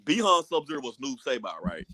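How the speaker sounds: ordinary talk, nothing marked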